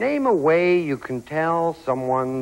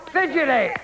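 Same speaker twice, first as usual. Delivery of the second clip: calling out; 8 semitones higher than usual. Real, not acted. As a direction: call it out to the board. Host calls answer to board.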